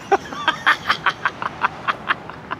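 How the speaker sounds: long breathy real laugh. Real, not acted. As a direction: collapse laughing after the rant. Laughs at own impression.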